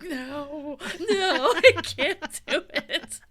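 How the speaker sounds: slow, half-laughing, half-crying no; imitating her own panic while laughing; laughing refusal. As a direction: laugh through a mock plea. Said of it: "And I was like".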